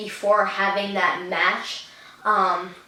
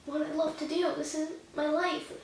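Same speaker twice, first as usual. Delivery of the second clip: quiet emotional quote; 6 semitones higher, 10 dB quieter than usual. Retold or imitated. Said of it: "and I said".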